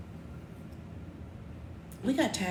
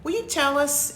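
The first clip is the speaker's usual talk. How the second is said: ordinary delivery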